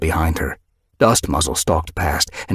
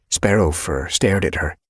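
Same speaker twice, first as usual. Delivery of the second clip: ordinary delivery, nothing marked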